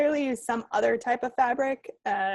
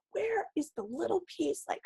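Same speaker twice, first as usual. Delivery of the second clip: higher-pitched, mock-exasperated rant; stomping-mad grumble; 6 semitones higher than usual. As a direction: complain in a huff, imitating your own tantrum. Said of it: "literally stomping around".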